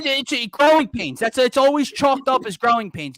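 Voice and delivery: loud, high exasperated retort; heated interjection. Real, not acted. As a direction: cut in loudly, fed up with excuses. Mocks fans' excuse, cutting in.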